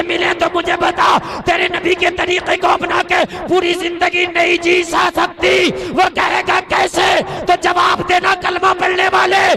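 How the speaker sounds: high, shouted debate reply; full-force preaching. Acted. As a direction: thunder the rebuttal at an opponent. (ऊँची आवाज़ में गरजता जवाब)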